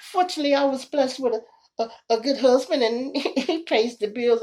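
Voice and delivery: nervous wife. Retold or imitated. Wife nervously explaining to police.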